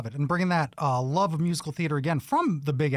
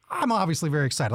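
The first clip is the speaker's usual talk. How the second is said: ordinary talk, nothing marked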